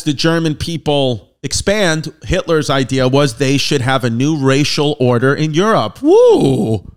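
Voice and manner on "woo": much higher and louder "Woo!"; a sarcastic whoop of fake celebration; mock cheer.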